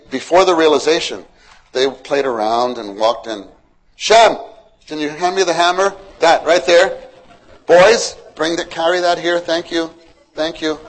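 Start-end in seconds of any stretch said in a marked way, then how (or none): 3.9-5.9 loud, higher call to his son; Noah calling out. Acted. 6.2-7.0 a touch of a busy father; Noah directing. Acted. Noah pointing out tool. 7.7-10.8 put-on higher, louder call to the boys; cheerful dad giving chores; father to sons. Father directing his sons.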